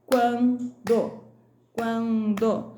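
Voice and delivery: "quando" split slowly into quan - do; syllable by syllable. Shown as a demonstration. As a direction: break "quando" after quan, sounding each syllable fully. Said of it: "separe as sílabas das palavras destacadas". ("quando" separado em sílabas, quan - do)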